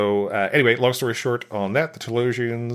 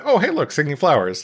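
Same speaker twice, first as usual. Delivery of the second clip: bright, raised, delighted voice; excited mock quote; 7 semitones higher, 5 dB louder than usual. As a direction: sound thrilled at the flowers. Mock line about singing flowers.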